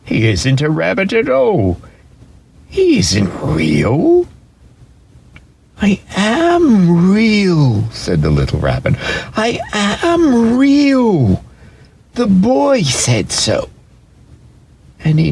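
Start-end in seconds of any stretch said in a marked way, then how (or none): 0.0-4.3 drawn-out, disdainful rabbit voice; slow accusing wild rabbit. A narrator's reading. Wild rabbit rejecting the toy rabbit, slow and disdainful. 5.8-13.7 high, very slow, pleading little voice; cling to it, almost crying; pleading, near tears. A narrator's reading. Toy rabbit pleading that he is real, near tears.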